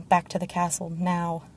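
ordinary delivery

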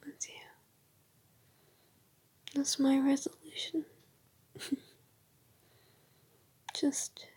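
drowsy goodnight whisper; sleepy whisper. Acted.

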